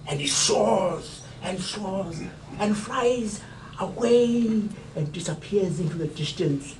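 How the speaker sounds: ordinary talk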